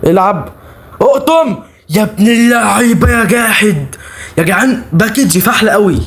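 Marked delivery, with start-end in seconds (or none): 1.0-3.9 slow, stretched, high cheer; high goal shout. Real, not acted. Gamer cheering his player's chip goal, slow high shout. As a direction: cheer the chip finish, drawing the words out.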